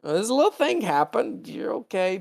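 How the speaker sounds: doctor easing Spock into bad news, high and halting; hesitant bad-news voice. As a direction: tiptoe around the news.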